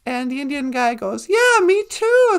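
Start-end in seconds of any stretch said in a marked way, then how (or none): 1.3-2.4 high, loud, drawn-out delight; excited agreement. Acted. Indian guy finds the biker shares his dream, excited agreement. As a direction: jump in, thrilled to share it.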